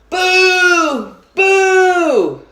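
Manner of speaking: boo the broken film. A narrator's reading. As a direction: crowd booing the stopped movie. Audience groaning at stopped film.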